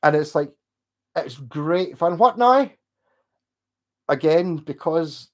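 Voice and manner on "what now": high, loud Northern Irish lilt; imitated Northern Irish accent, pitched up. Shown as a demonstration. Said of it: "slip in a Northern Ireland accent".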